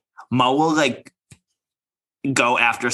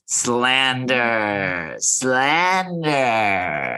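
long, theatrical mock chant; drawn-out mimicry; much slower than usual. Mimicking a castmate trying to have her moment, long drawn-out delivery. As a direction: draw each word right out, imitating her.

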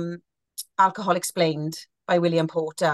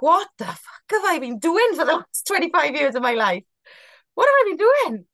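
rising to a high, louder outburst on the repeat; what have I been doing, in horror; stunned disbelief; 8 semitones higher, 4 dB louder than usual. Retold or imitated. Recalling her sober awakening, voicing her stunned disbelief. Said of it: "And I was like".

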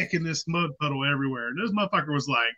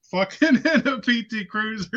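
ordinary talk, nothing marked

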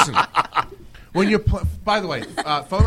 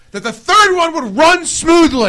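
loud, high, slow fed-up cry; exasperated outburst; 7 semitones higher, 6 dB louder, slower than usual. Real, not acted. Host venting at a crashing show, exasperated and loud. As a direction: beg the room for an answer, fed up. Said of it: "Someone's angry".

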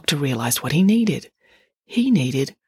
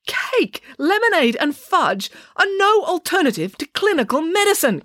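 high, indignant complaint; complaining; 13 semitones higher, 4 dB louder than usual. A narrator's reading. Doctor defends clinical medicine against cake, complaining. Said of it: "complained the doctor".

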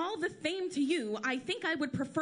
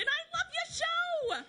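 starstruck gushing; 14 semitones higher, slower than usual. Acted. Starstruck waitress meets celebrity.

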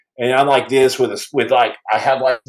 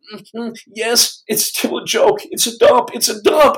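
high, half-whispered defiant answer; defiant shivering; 8 semitones higher than usual. Retold or imitated. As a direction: shiver out a stubborn yes. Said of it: "I'm like this".

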